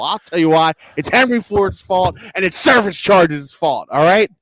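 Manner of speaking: worked-up tirade with loud bursts; heated rant. Real, not acted. Comic blaming Henry Ford for service charges, heated rant. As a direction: build up steam and punch the swear words. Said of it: "End rant".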